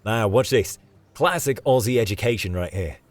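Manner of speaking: Australian guide about to demonstrate a stunt; bold, excited announcement; cocky Aussie David. Acted. David shows off.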